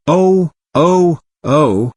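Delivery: put-on a lost girl wailing in the snow. A narrator's reading. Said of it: "wailed Helen".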